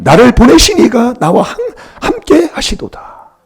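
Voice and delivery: high, loud, slowing on 함께; emphatic recitation. Shown as a demonstration. Preacher repeating the verse to the congregation, emphatic. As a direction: stretch out 함께 하시도다 slowly. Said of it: "preacher repeats the verse emphatically".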